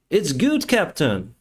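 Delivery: imitating a beaming first officer; retold cheerful officer. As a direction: say it like happy news. Officer calls pilgrims' deaths good.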